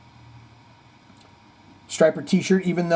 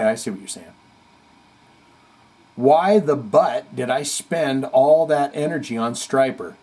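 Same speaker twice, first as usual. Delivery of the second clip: ordinary delivery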